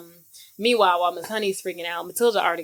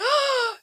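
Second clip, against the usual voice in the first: sharp, very high frightened gasp; startled gasp. Retold or imitated. Miss Trunchbull sees the flying portrait, high frightened gasp. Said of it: "And then she's like".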